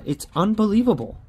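exasperated outburst at a losing ticket; disbelief. Real, not acted. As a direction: throw "It's unbelievable" up high in exasperation.

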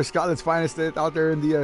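ordinary delivery

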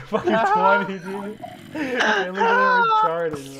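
high, slow, crying whine; mocking whine. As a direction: whimper it to mock him. Teasing drunk friend.

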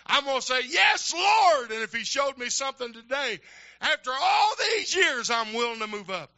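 loud, high, fervent preaching, crying out at peaks; preaching at full force. Acted. Pastor rousing his congregation at the close, preaching full force. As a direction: build it into a shouting revival sermon.

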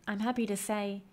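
plain reading voice

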